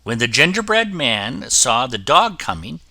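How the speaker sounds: usual speaking voice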